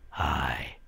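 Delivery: ordinary talk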